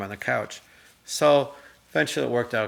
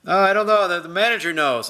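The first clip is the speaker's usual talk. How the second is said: clerk voice; 7 semitones higher, 5 dB louder than usual. Retold or imitated. Retold clerk answering.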